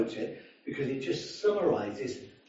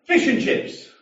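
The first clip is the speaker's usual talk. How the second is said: put-on loud slurred drunk order, higher; 8 semitones higher, 6 dB louder, slower than usual. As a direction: play the swaying drunk barking his order. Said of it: "he said to the woman".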